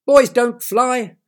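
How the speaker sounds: very loud, slow, high squawk; scolding bird. Acted. Bird squawks at Derek, loud slow scolding. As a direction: bird sternly denying.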